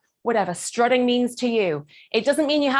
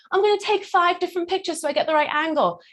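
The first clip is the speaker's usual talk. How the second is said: raised, lightly mocked selfie voice; selfie taker; 6 semitones higher than usual. Coach mocking Instagram and filters, lightly voiced selfie taker. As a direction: mimic a picture-obsessed poser mildly. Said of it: "Instagram and filters and cameras goes".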